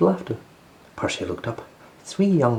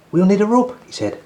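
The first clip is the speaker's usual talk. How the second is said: urgent Percy; 13 semitones higher, 5 dB louder than usual. Acted. Percy hurrying to rescue.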